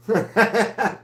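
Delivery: a short high laugh at herself; high giggle. Real, not acted. Streamer laughs at her own silly voice. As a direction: giggle high after the joke.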